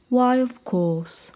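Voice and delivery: lightly boomed, very slow; booming elephant. Acted. Elephant agreeing to help, slow mild boom. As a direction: stretch it out like a gentle giant. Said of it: "boomed Alfred".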